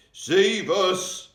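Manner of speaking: loud, high, desperate scream; a giant shrieking in his nightmare; giant's scream. A narrator's reading. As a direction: scream for help as if in terror. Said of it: "screamed the flesh lump eater".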